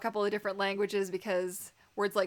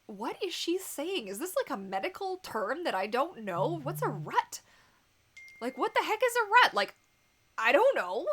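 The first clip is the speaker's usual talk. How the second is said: confused; 6 semitones higher, 4 dB louder than usual. Retold or imitated. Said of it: "And I'm like".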